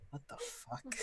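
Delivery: high, hushed bewilderment; puzzled, quiet high-pitched reaction; quizzical. Acted. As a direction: ask it softly and high, baffled. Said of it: "a very quizzical head tilt from [name]".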